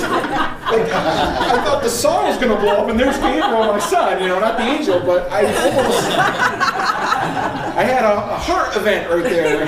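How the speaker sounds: loud, high, laughing through it; laughing loudly at his own scare. Real, not acted. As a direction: tell it while cracking up. Laughing retelling of his fright.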